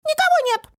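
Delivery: very high, squeaky small-creature voice; little voice. A narrator's reading. Hidden little creature answers Фантик, tiny high squeak. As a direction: squeak it out from hiding. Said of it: "раздался голосок".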